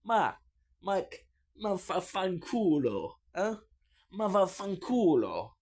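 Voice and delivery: hammed-up Italian swearing at a stranger; mock Italian cursing. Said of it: "you do like that to them".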